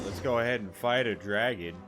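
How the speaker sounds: normal talking voice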